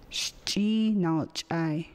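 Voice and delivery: Caddo word "Shchi Nalchai" said very slowly, syllable by syllable. Shown as a demonstration. Teacher models the Caddo word "Shchi Nalchai" slowly for learners. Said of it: "I am excited".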